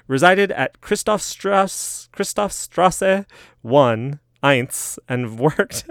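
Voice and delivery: slow mock German street name; lightly put-on German accent; hammed-up German pronunciation. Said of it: "keep this German accent going".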